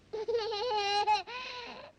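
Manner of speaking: long high infant squeal; very high baby cooing. Acted. Baby responds to cab driver.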